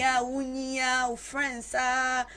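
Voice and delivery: ordinary talk, nothing marked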